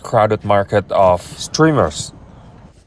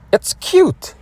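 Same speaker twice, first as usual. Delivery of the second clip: squealing "it's cute" much higher. Real, not acted. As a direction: coo over it like a tiny puppy. Cooing over tiny gadget.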